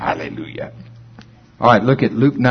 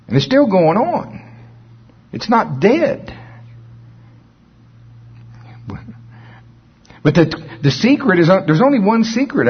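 raised, urgent preaching voice; fervent preaching; 7 semitones higher than usual. Acted. Pastor urging congregation, raised and urgent. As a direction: press the point home.

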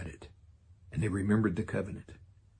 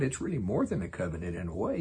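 normal talking voice

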